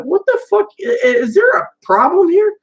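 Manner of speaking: high, squeaky mock outrage; hammed-up shocked relative; mock outraged rant. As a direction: send the pitch way up in fake indignation. Mock rant from sister-in-law.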